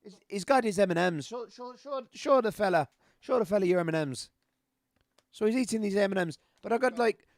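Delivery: high, loud Irish brogue; southern Irish accent. Shown as a demonstration. Streamer telling someone to show the fella, in a loud Irish accent. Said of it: "Irish accent, it'll always be a Southern accent".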